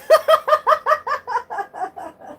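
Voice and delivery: squealing laugh; high loud laugh. Real, not acted. Laughing at her own viewer impression.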